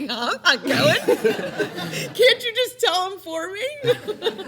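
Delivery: laughing plea. Retold or imitated. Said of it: "I'm like".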